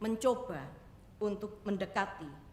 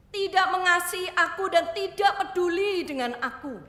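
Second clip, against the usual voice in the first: anguished outburst; 9 semitones higher, 11 dB louder than usual. Acted. Girl complains about her mother.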